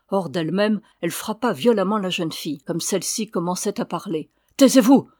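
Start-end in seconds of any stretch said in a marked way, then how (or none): 4.6-5.1 Taisez-vous crié fort et plus haut; hurle-lui de se taire; cri furieux. Acted. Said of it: "cria-t-elle de nouveau". (cri furieux hors d'elle)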